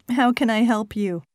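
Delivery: ordinary talk, nothing marked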